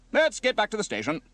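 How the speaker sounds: high, loud command; officer's order. Acted. Officer orders crew back.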